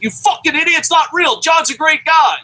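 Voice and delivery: high, loud mock rant at a fool; mock angry yell. Guy mocking a critic, high and loud mock yell. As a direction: yell it like he's calling someone an idiot. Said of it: "He's like".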